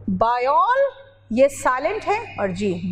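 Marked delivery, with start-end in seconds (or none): 0.1-0.9 बायोल as a drawn-out "baa-yol", rising; English pronunciation. Shown as a demonstration. Teacher shows the English start of biology, बायोल drawn out as "baa-yol". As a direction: stretch बायोल, closing on the l. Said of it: "English में पता pronunciation क्या है?".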